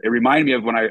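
usual speaking voice

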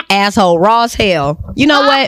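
ordinary talk

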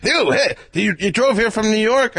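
high, loud burst of energy; energetic Mel Brooks. Retold or imitated. Mel Brooks bursts in on the guest, full of energy. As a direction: burst in like a musical number. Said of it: "with a ton of energy".

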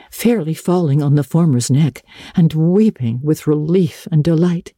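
ordinary delivery

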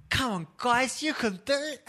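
high rallying pep talk; motivational manager. Retold or imitated. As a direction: gee up the players like a manager. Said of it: "someone to come and go".

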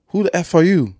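put-on voice, pitched high; high mock confrontation with odd accent; retold intruder. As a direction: imitate a startled stranger challenging you. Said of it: "You doing an accent? Why you talking like that?".

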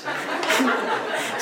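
breathy laugh. Real, not acted. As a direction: chuckle under your breath. Laughs at own diary. (zacht grinniken)

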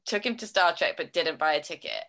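ordinary delivery

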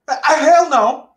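high, loud and slow hell no; incredulous loud no; emphatic refusal. Real, not acted. Asked if he'd lead stalker home.

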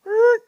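high strained creak like a splitting tree. Retold or imitated. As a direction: voice the tree groaning as it pinches. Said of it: "the tree goes".

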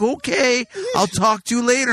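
high, over-friendly careful goodbye; mock-careful sign-off, pitch up; exaggerated slow talking. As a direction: imitate patronizing someone slow. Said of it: "trying to talk to me slower".

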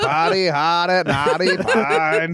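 slow, high Boston drawl; slogan drawn out like a Boston mayor; mock Boston accent. Co-host joining the bit to plug the event, in a slow mock Boston accent. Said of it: "Mayor Quimby".

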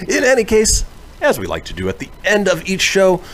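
upbeat host delivery; radio host. Acted. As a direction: pitch it bright to listeners. Host segment intro.

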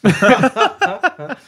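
loud high laugh; real loud laugh; high burst of laughter. Real, not acted. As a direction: crack up at the story. Laughing at the deal.

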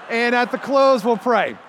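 loud, high, booming call to prayer; cheerful loud pastor announcement; hearty announcement. Retold or imitated. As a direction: announce it brightly to the whole room.

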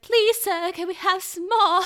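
high, loud pleading orphan; orphan begging voice. Pretend. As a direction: beg like a hungry little orphan. Begging for more food.

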